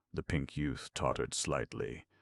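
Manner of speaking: ordinary delivery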